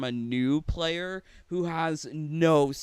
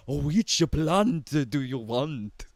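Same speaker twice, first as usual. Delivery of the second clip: slow, loud, bad Russian accent; mock Russian accent; 6 dB louder, slower than usual. Imitating Olga the plant seller from Russia, mock Russian accent. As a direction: drag it out in a hammy Russian accent. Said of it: "How do you do a Russian accent?".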